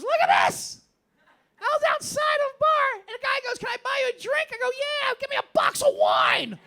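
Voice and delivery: loud, very high imitation of a drunk woman; hammed-up high-pitched drunken bragging; drunk proud boasting. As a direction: shout it like a proud drunk showing off a prize.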